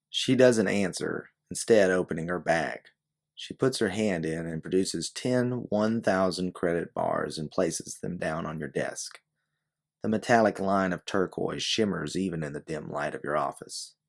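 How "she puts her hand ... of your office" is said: ordinary delivery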